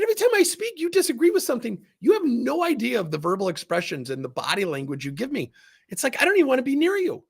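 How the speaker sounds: high, lively retold scolding; animated rebuke. Telling off the intern, retold.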